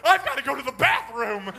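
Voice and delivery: put-on high, loud frantic kid voice; a stalling son begging for the bathroom; urgent child. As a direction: plead like a boy who must run. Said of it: "you're not gonna have water".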